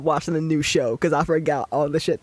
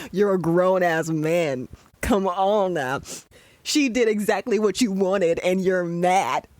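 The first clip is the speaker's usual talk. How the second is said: usual speaking voice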